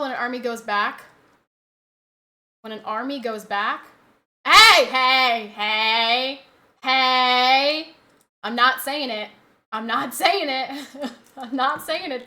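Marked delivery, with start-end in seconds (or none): 4.4-7.9 loud, drawn-out "hey"; stretched, forceful warning; warning call. Real, not acted. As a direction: cut them off with long loud heys. Cutting off talk near dog.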